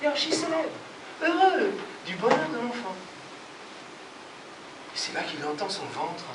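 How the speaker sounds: ordinary delivery, nothing marked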